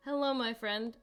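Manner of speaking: usual speaking voice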